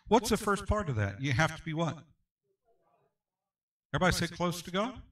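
ordinary talk

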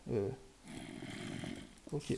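ordinary talk

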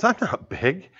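put-on a little elephant boy, hurt and baffled; little Tembo. Little elephant protests to mother.

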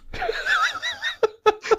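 high burst of laughter; laughing. Real, not acted. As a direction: crack up hard. Laughs at vomit story.

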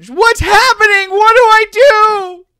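a terrified mother shrieking into the comm; panicked mother. Acted. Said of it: "Dash's mother is clearly panicked".

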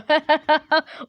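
loud burst of laughing; amused cackling laugh; laughter. Real, not acted. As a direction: laugh at your own silliness. Laughs at own reading.

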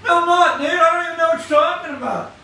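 high, loud retort of disbelief; loud protest. Retold or imitated. New believer protesting to churchgoers who say he's preaching, a loud high retort. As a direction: fire back that you don't even know it.

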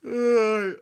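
loud, high yawning groan; real yawn. Real, not acted. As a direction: let a big yawn out. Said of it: "I'm sorry, I'm yawning".

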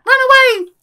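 very high, loud squealed cry; high-pitched yell. Acted. Player flees the enemy, high squealed yell. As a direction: shriek it in comic alarm. Comic retreat in game.

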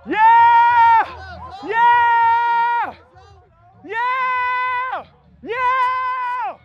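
high, loud hype yells; excited yells. Real, not acted. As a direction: scream it out, pure excitement. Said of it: "I'm just excited".

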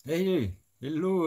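drawn-out, high mock English greeting; mock English accent. Greeting the UK viewer in a hammed-up English accent. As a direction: stretch the hello in a put-on English accent.

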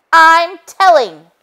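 high, loud and slow; sing-song tattletale threat; childish tattling. A narrator's reading. Detective threatening to tell Chief Goose, loud and childish.

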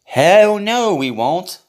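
loud, high emphatic no; emphatic shout. Real, not acted. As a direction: blurt it out loud. Emphatic answer.

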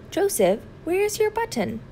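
a mother asking where the button went; questioning mother. Acted. Mother asking about lost button.

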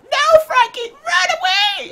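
very high, loud imitated shriek; mock panicked scream. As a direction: scream the warning, hammed-up and high. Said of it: "literally just like".

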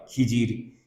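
ordinary talk

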